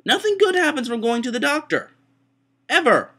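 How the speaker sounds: high, loud outburst of complaint; hammed-up indignant yelling; exasperated rant. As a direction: wail it out at the top of your range. Ranting about hating the doctor.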